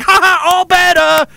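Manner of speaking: high, gleeful Chinese-accent imitation; mock Chinese accent. Chinese commercial after the washing machine, gleeful mock Chinese accent. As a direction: crow it in a put-on Chinese accent.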